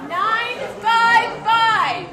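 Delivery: slow, loud number call; raffle caller. Real, not acted. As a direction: draw each digit out loudly. Calling raffle numbers to crowd.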